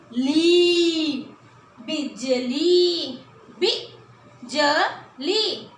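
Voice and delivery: ली and बिजली said slowly with long ली; long li drill. Shown as a demonstration. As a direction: stretch the ली in बिजली. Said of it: "छोटी ई की मात्रा है". (बिजली में ली को खींचना)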